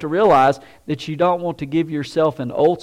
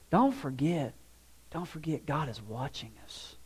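much softer, nearly under the breath; hushed warning; 3 semitones lower, 13 dB quieter than usual. Real, not acted. Preacher reminding the congregation, much softer and hushed. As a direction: lean in and hush it.

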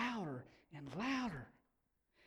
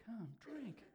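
soft, lingering appeal; hushed invitation; 3 semitones higher, 12 dB quieter than usual. Acted. Preacher offering the invitation to a thirsty people, hushed. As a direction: let the invitation drop to a quiet call.

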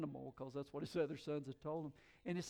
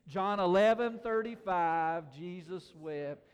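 loud, slow call for all to repeat; louder rallying recitation; 3 semitones higher, 10 dB louder, slower than usual. Shown as a demonstration. Preacher urging listeners to do better, loud recitation. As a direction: belt out the reference, drawing out each word. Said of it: "You can do better than that. Come on".

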